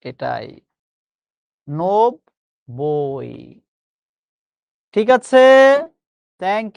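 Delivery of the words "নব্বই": নব্বই stretched very slowly as nob-boi with a doubled ব; drawn-out teacher's নব্বই; slow pronunciation demo. Shown as a demonstration. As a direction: model নব্বই syllable by syllable. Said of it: "নব্বই শব্দের সঠিক উচ্চারণ কিন্তু".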